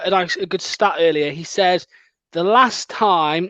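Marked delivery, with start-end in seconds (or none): 2.3-3.5 very slow, drawn-out reading; deliberately slowed read-out; said slowly. Shown as a demonstration. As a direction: stall each word while finding the text. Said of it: "I'm gonna say this slow".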